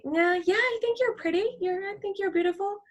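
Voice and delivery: high, soft, fake-sweet reassurance; fake placating. People placating her, high fake-sweet.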